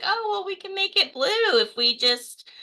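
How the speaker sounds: high-pitched mock quote of industry spin; facetious. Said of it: "I'm sorry, I'm being facetious".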